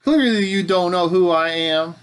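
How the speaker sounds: loud, high southern-accented mock boast; hammed-up southern indignation. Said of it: "slipping into a southern accent when I say that".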